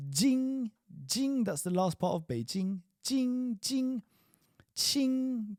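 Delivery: jing, qing and xing high with a falling tone; jing qing xing drill. Shown as a demonstration. Teacher drilling jing, qing, xing, high and falling. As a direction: model each ing syllable crisply. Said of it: "I want to really hear the ng".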